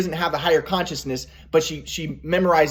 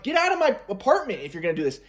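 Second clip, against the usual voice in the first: ordinary delivery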